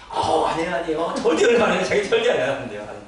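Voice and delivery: loud, insistent student denying it; a laughing, emphatic no from the kid; loud denial. Retold or imitated.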